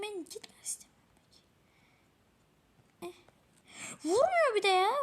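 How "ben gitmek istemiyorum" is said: mock whisper.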